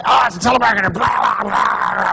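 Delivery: flustered outburst into gibberish; mock panic. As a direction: babble in comic alarm. Acting out panic at a telemarketer.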